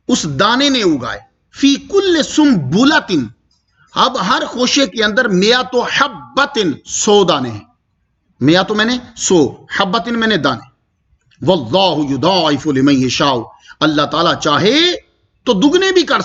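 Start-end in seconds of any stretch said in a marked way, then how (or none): 1.5-3.1 slow, raised chanted Arabic; recited phrase. Acted. Recites verse phrase within tafsir. 11.4-13.4 slow chanted Arabic; recited phrase. Acted. Recites verse phrase within tafsir.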